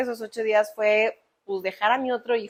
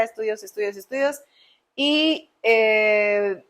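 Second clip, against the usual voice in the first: ordinary delivery, nothing marked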